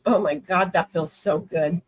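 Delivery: ordinary delivery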